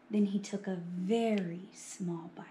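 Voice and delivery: soft, whispery narration; hushed suspense. A narrator's reading. Narrator as Buster dares a tiny bite, hushed. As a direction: hush it as everyone watches.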